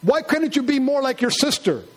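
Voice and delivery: lightly voiced nagging parent; mock nagging parent. Parent comparing child to sister in a mock argument.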